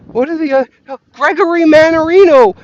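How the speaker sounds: high, loud flustered calling; hammed-up panicky host; mock panic. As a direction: stammer then blurt the name. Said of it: "to being".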